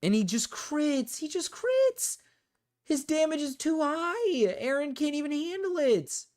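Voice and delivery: real high whining despair; desperate pleading. Real, not acted. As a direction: beg in a high, helpless wail. Losing battle, begging to switch.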